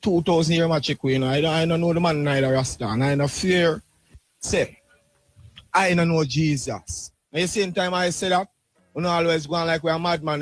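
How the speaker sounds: ordinary delivery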